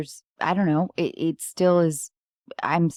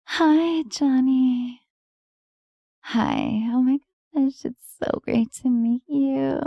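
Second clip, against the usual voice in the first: higher, breathy-styled flirty greeting; sexy voice; 8 semitones higher, slower than usual. Shown as a demonstration. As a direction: purr it out, pitched up and flirty. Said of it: "slightly higher pitch, sexy voice".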